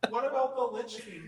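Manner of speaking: normal talking voice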